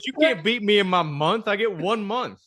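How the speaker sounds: high-pitched retold protest; mock-indignant boast. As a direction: insist on it like a trash-talking buddy. Retold trash-talk follow-up to punchline.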